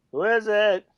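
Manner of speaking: high, loud call through the door. Retold or imitated. Answering a knock at the locked front door, calling out. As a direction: holler to whoever just knocked.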